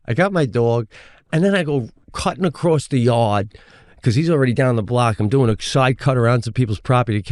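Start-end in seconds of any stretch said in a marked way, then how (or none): none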